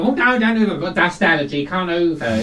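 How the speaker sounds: camp refusal. Acted. Cleaner telling a client he won't hoover, camp protest. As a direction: refuse the chore with camp indignation.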